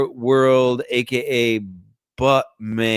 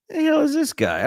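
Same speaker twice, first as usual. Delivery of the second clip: baffled. Real, not acted. Baffled by player record.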